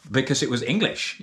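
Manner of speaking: ordinary talk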